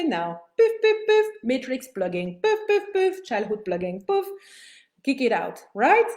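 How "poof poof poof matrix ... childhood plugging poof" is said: high, loud, punchy poof sounds; playful poofs. Shown as a demonstration. Coach banishing unwanted thoughts, high loud poofs. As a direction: flick each thought away with a bright poof.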